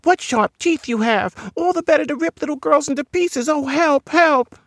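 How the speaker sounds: very high, panicked squeal; frightened creature. Acted. Creature flees sharp teeth, high-pitched panic. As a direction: cry for help in a tiny high voice.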